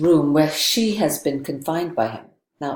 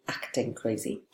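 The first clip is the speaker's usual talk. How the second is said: scare-quoted aside; 6 semitones lower, 10 dB quieter than usual. Retold or imitated.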